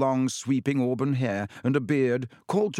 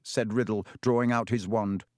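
usual speaking voice

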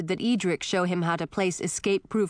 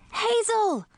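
shouting. A narrator's reading. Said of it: "shouted Millie".

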